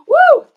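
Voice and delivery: high, loud woo; emotional whoop. Real, not acted. Missing that lady on a live, a high loud woo. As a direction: whoop it out to recover composure.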